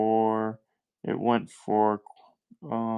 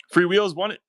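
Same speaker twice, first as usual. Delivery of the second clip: higher, louder burst of excitement; excited winner announcement; 9 semitones higher, 6 dB louder than usual. Real, not acted. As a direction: call out the winner with a lift.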